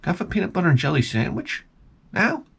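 put-on fast, whiny little-kid ask, rising high on now; a hint of a needy little kid; kid asking mom. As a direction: beg mom like a small child.

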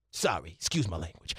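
very low, soft apologetic aside; Stephen A Smith. Acted. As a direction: drop the volume for a quick mock apology. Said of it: "Stephen A Smith".

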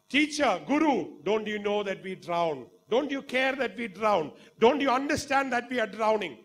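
high, loud, pleading cries; panicked disciples. Acted. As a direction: beg the teacher as the boat sinks.

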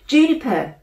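high, loud indignant call. A narrator's reading. Brother indignantly calling his sister to help, loud. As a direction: demand she take it seriously.

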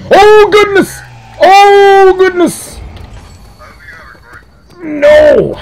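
very high, loud shrieks with drawn-out vowels; panicked scream. Real, not acted. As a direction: scream in sudden game fright. Frightened by attack in game.

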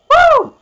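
shrill victory whoop; high whooping cheer. Real, not acted. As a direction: whoop at the top of your voice. Celebrating the race win.

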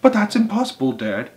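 high protesting little monkey; small monkey crying out. A narrator's reading. Small monkey protests to Dad, crying out. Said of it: "cried one of the small monkeys".

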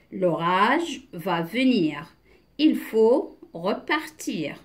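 slow, deliberate read-aloud; measured reading with long pauses between phrases; teacher's model reading. Shown as a demonstration. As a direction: read word by word for beginners. Reads text to beginners.

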